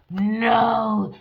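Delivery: dramatic gasp of shock; shocked gasp then no. Acted. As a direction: react in horror. Shocked at the card.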